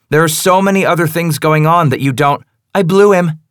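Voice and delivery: high, loud, defiant female voice; defiant young woman. Acted. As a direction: fling the confession in his face.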